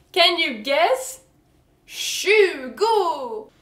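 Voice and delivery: slow, high, loud mock announcement; theatrical reveal. Shown as a demonstration. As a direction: draw it out loudly as a big reveal. Dramatic reveal of odd pronunciation.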